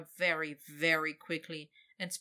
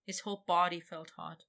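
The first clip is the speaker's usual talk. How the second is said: plain reading voice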